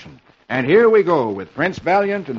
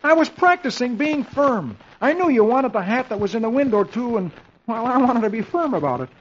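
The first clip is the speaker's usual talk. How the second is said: high-pitched husband character; sheepish husband; 9 semitones higher than usual. Acted. Dagwood explains to Blondie about the hat, high sheepish voice.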